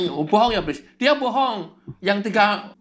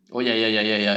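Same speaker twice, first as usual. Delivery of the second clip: meek low yes-man; 10 semitones lower than usual. Acted. Follower meekly gives in to the devil.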